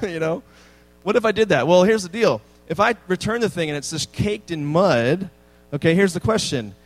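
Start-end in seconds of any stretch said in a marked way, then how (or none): none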